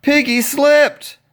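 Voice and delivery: usual speaking voice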